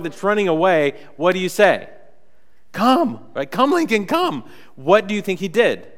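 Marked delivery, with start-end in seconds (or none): 2.7-3.2 high pleading call; calling the dog. Retold or imitated. Owner calling the fleeing dog, high call. 3.5-4.4 high pleading call; calling the dog. Retold or imitated. Owner calling the fleeing dog, high call.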